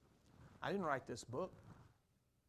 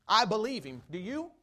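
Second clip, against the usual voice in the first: much louder, punchy declaration; loud challenge; 5 semitones higher, 10 dB louder than usual. Real, not acted. Preacher challenges his congregation, loud and punchy. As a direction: call out your faith and dare them.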